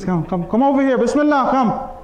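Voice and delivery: eager invitation. Retold or imitated. Imam urging the wary messenger to come over, eagerly inviting. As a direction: beckon him over insistently.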